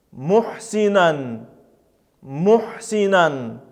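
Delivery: measured, drawn-out word with a falling end; Arabic reading drill. Shown as a demonstration. Teacher models tanween examples for learners, careful drawn-out recitation. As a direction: recite each word singly, stretching the long vowels.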